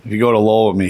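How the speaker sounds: normal talking voice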